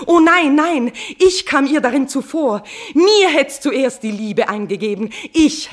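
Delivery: queen crying out her love; passionate outburst. Acted. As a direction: burst out with desperate passion.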